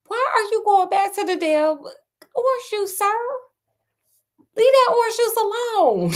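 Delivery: high sassy scolding of the character. Real, not acted. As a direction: yell at him to leave the juice. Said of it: "scolding the character, high timbre".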